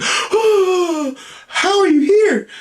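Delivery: very high, breathless squeal of shock; mock shocked girlfriend. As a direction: act out her astonishment in falsetto. Girlfriend shocked seeing Jesus alive.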